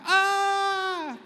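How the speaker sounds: high scream after the thud; scream after the fall. Shown as a demonstration. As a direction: land first, then scream. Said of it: "Sa second floor". (sigaw pagkabagsak)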